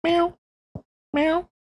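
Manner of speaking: high squeaky meow, like a tiny kitten; cat meow. Pretend. Host mews for his tiny cat in a high squeak. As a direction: mew like a little kitten.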